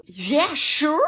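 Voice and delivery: loud, excited yes; surexcited reply. Retold or imitated. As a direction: jump at the offer, overexcited. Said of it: "parce que je suis surexcitée". (surexcitée)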